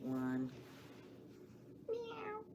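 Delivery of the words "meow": tiny, very high, very soft meow; a little kitten mewing. Acted. Kitten voiced in poem.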